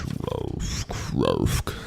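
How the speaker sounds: low guttural zombie groan; zombie moan. Pretend. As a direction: growl it like a zombie, up close. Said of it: "A S M R zombie noise".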